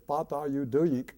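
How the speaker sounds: put-on teacher's Russian accent, drawn out and loud. Strict teacher scolding a goofing-off student, in a Russian accent. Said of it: "in that accent".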